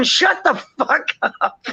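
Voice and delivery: cursing parrot. Retold or imitated. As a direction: yell it like the parrot telling the dog off. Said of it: "on Gary's shoulder going".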